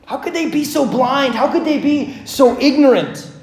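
baffled outrage at the mob; preacher's rising disbelief at the crowd; indignant. Real, not acted. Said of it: "How do you not get mad".

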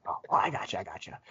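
hushed, embarrassed mumble; sheepish whisper. Retold or imitated. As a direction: whisper like he got caught out. Opponent sheepishly realizing wrong box.

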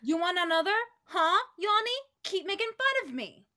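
high, loud challenge; angry threat. Acted. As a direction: dare him to try it. Threatens another blow after mockery.

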